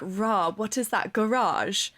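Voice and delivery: slow, sneering posh British schoolgirl; mock posh British disdain. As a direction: sniff at it like a posh British TikTok girl. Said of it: "posh girls on TikTok are like".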